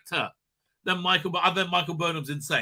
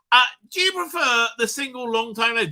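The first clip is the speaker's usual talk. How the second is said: usual speaking voice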